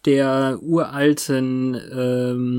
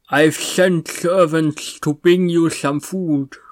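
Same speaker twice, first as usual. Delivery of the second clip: slow, drawn-out villain quote; imitierter Meister; 2 semitones higher, slower than usual. Retold or imitated. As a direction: intone it slowly as the vampire Master. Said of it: "sagt er ja dann". (langsam nachgeahmter Meister)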